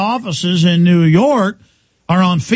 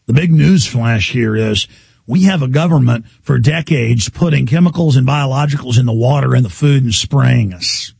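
ordinary delivery

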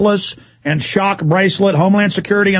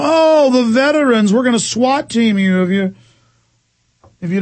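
high-pitched parody, easing down; mocking threat; 4 semitones higher than usual. Host voicing officials threatening veterans, mockingly.

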